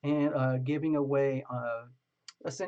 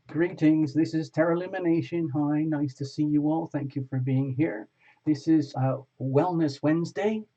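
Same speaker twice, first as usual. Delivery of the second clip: louder, upbeat broadcast host voice; show host opening; 6 dB louder than usual. Acted. Host welcoming viewers to Wellness Wednesday, upbeat announcer voice.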